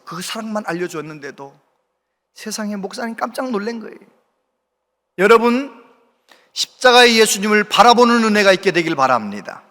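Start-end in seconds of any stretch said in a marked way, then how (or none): 5.2-9.6 louder, ringing sermon close; fervent preaching. Acted. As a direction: preach it out to the whole congregation. Preaching climax. (설교 끝부분을 힘차게 외치는 목소리)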